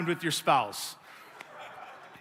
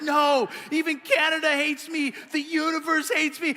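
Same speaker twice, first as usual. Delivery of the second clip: high-pitched, hammed-up protest; over-the-top self-pity; 8 semitones higher than usual. Pouting husband arguing with wife, over-the-top self-pity. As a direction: whine it high as if wronged by everything. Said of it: "I was just over top".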